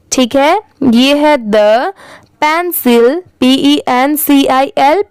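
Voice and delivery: ordinary delivery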